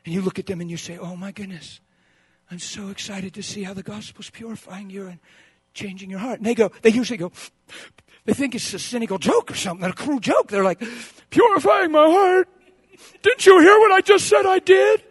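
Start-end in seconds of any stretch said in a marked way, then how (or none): 1.0-6.4 hushed wonder. Acted. Quiet reply to crying confessor. 11.3-15.0 high, strained disbelief; offended, upset questioning; mock incredulity. Imitates incredulous tearful confessor.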